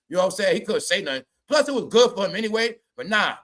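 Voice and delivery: ordinary delivery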